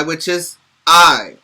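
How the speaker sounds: "I" said as the long sound in ice; long i demo for kids. Shown as a demonstration. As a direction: stretch "I" into the long eye sound. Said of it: "the other sound of the letter I".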